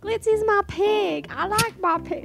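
kid impression. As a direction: talk like a little kid through a hand. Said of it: "She's a great impressionist".

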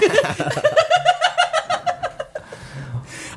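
long high-pitched laugh; laughter. Real, not acted. Co-host cracking up at his friend hating his life, laughing.

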